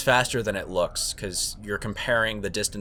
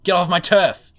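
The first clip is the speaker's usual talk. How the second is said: hammy Brooklyn tough guy; Brooklyn accent; 5 semitones higher, 7 dB louder than usual. Pretend. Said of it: "Just trying to do a Brooklyn accent".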